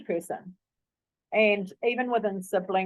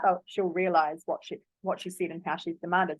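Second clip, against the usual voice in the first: normal talking voice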